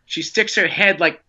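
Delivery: plain reading voice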